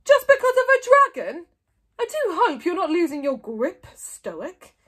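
sneering. A narrator's reading. Mogadon hoping Stoic is losing his grip, sneering. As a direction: sneer loudly at a rival. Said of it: "he sneered".

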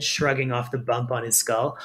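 ordinary delivery, nothing marked

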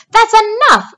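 high, loud outburst; rat cries out. A narrator's reading. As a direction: cut the sheep off sharply. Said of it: "cried Templeton".

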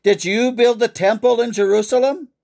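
screamed. A narrator's reading. Herod raging in Eliakim's face, screaming with some restraint. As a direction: hurl the question at him, short of a full scream. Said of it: "screamed in Eliakim's face".

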